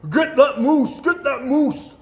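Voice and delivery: high, loud hunter's cry; loud, high hunting shout; hunters shouting. Acted. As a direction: yell it across the hills at the moose.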